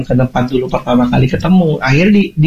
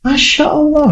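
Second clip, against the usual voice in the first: exasperated cry; 13 semitones higher than usual. Retold or imitated. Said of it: "Saya bilang".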